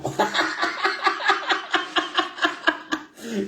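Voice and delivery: high unvoiced laughter; infectious laugh. Shown as a demonstration. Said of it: "le rire, c'est communicatif".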